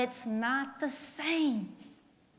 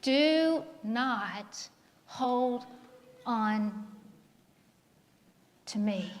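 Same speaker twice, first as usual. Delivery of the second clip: slow emphasis; 3 semitones lower, 4 dB quieter, much slower than usual. Shown as a demonstration. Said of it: "Do you hear that, church?".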